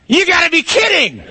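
high, loud yell of disbelief; incredulous shout. Acted. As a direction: shout it back in disbelief over the wind. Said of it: "he looks at me and he goes".

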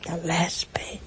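soft, half-whispered echo; hushed sorrow. Real, not acted. Preacher dwelling on the mother's last pain, hushed. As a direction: repeat it softly, almost under the breath.

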